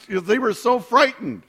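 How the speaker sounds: ordinary delivery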